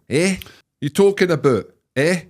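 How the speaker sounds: high, loud Glaswegian demo; Glaswegian sentence. Shown as a demonstration. Asked to use "eh" in a sentence, loud Glaswegian. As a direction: punch it out like a Glasgow local. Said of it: "put it into a sentence".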